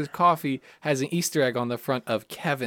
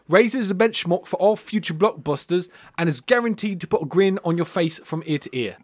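put-on hammy British accent with dropped r's; bad British accent. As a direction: read it like an American badly faking a British critic. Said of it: "Is that a Don Cheadle impression?".

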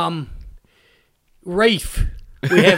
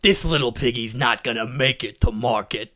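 low movie-trailer voice; deep trailer-announcer delivery; 7 semitones lower, 4 dB quieter than usual. Acted. Henchman on a comedy podcast, movie voice. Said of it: "I accidentally did movie voice for Tommy".

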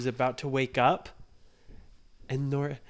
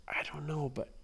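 whispered; 3 semitones higher, 5 dB quieter than usual. Acted. Kids hiding near sleeping giant.

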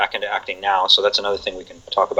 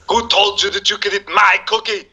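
much higher, barking Arnold voice, slowing at the end; Arnold impression; 10 semitones higher than usual. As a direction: scold like Arnold over a cookie. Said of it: "sometimes I'll do Arnold".

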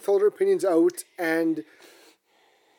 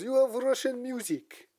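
put-on Russian accent; 7 semitones higher, 4 dB quieter than usual. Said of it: "he had a very thick Russian accent".

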